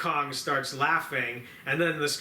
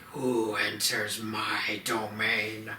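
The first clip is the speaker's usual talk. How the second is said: lower, very slow menacing king; villain king; 5 semitones lower, much slower than usual. Retold or imitated. The Scar King challenges intruders, low and slow. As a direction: lower and stretch every word like a tyrant. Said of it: "and he's like".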